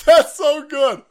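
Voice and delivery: ordinary delivery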